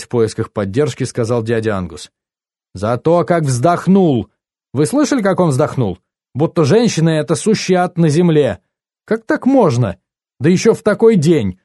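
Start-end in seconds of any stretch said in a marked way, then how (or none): 2.7-11.6 high, indignant wailing complaint; nervy aunt making a great fuss; lamenting. A narrator's reading. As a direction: lament dramatically, climbing in pitch. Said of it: "причитала тётя Джесс".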